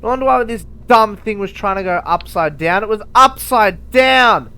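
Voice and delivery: loud, high disbelieving rant; high, loud incredulous exclamation; exasperated realization. Real, not acted. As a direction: blurt it out in disbelief. Realizing his mistake.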